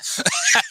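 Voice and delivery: high breathy burst of real laughter. Real, not acted. Host cracking up at her own quip, real laugh. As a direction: laugh at your own comeback.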